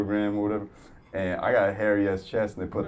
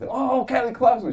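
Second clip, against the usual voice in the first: high, loud singer-like exclamation; a hammed-up high shout; mock diva; 6 dB louder than usual. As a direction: belt the name like a bit. The bit he planned.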